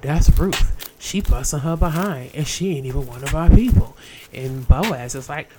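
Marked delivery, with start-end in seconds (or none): 0.0-4.0 impressed overseer. Acted. Overseers telling Boaz.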